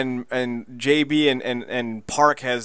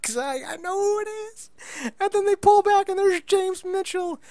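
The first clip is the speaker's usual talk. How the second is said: thrilled squeal. Real, not acted. Fan thrilled recognizing James Mitchell.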